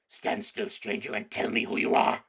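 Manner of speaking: loud rasping order; growling wolf command. Acted. Wolf guard ordering the stranger to freeze, growling. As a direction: pin him where he stands. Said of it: "said in a growling voice".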